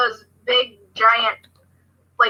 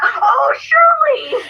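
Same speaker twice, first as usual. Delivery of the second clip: high excited cry of the name; suddenly recognize her and call out; high-pitched oh Shirley; surprised recognition; 13 semitones higher, much slower than usual. Retold or imitated.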